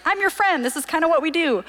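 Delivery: retold protest to an over-apologetic friend; mock exasperated reassurance. Roommate answering her friend's needless apology, high insistent reassurance.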